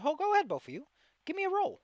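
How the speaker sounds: high, eager urging; excited. Real, not acted. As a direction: hype them up to roll. GM urging players to roll.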